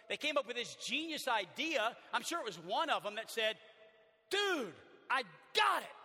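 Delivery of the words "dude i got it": loud, slow eager shout of a friend with a plan; excited friend. Acted. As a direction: call it out like a bright idea just hit. Friend pitches roof idea.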